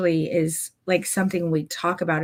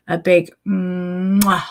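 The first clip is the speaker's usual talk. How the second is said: a slow, loud, drawn-out mwah; an exaggerated air kiss; big kiss; 5 dB louder, much slower than usual. Shown as a demonstration. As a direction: blow a big smacking kiss. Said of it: "Kissy face".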